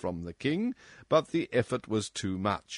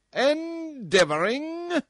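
much higher, slow, fierce outburst; fierce cry; 7 dB louder, slower than usual. Acted. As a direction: fling the word back furiously. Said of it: "cried the tyrant fiercely".

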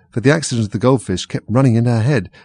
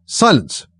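high, raised call for order; 7 semitones higher, 4 dB louder than usual. A narrator's reading. King interrupting the court, high called-out order. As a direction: call it out, pitched well up. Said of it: "called out".

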